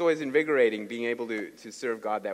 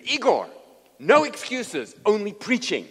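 loud, high, emphatic order; stern command; 8 semitones higher, 9 dB louder than usual. Retold or imitated. Preacher telling his sick translator to preach, loud stern command. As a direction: overrule all excuses forcefully.

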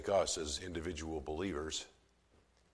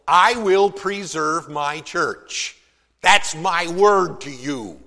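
preaching at full force; 8 semitones higher, 11 dB louder than usual. Acted. Preacher voicing God's promise to his church, loud and triumphant. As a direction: swell to a triumphant pulpit cry.

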